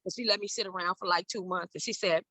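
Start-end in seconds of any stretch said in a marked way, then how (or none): none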